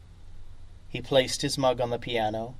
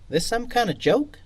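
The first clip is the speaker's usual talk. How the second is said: high, louder bristling question; incredulous challenge; 10 semitones higher, 5 dB louder than usual. Acted. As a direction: challenge the stranger, ready for a fight. Pianist confronts stranger, bar silent.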